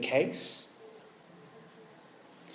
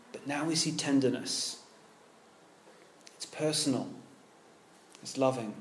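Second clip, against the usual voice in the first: tender; 5 semitones lower, 12 dB quieter than usual. Real, not acted. Tender turn in sermon.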